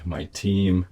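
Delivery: ordinary talk, nothing marked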